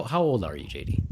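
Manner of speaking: normal talking voice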